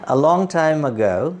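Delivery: "a long time ago" slow, as one sense group; slow phrased reading. Shown as a demonstration. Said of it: "যেমন". ("a long time ago" ধীরে একসাথে)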